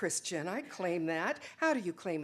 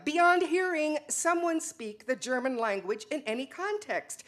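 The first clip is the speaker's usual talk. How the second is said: heated retort; 6 semitones higher, 4 dB louder than usual. Acted. Joseph explains what bothers him.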